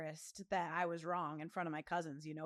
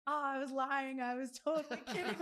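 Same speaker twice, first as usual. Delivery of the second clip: higher, laughing admission; laughing confession; 5 semitones higher, 3 dB louder than usual. Retold or imitated. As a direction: own up to the prank through laughter. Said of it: "He's like".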